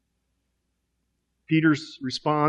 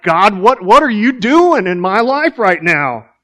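louder, higher, pleading confusion; exasperated prayer, much louder and higher; frustrated cry to God; 6 semitones higher, 9 dB louder than usual. Pretend. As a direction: cry out to God, bewildered, slowing at the end. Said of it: "and it's like".

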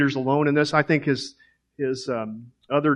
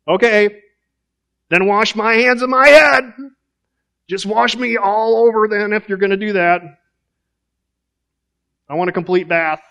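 much higher and louder, eager Peter; impulsive overreaction; 7 semitones higher, 7 dB louder than usual. Acted. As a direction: blurt it out, all or nothing. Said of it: "he's extreme, and he's impulsive".

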